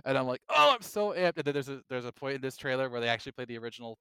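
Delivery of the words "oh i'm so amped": high, loud gush of excitement; acted-out fan excitement; re-enacted hype. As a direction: relive being amped, pitched up. Re-enacts own reaction to trailer.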